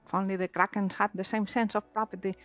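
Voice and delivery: usual speaking voice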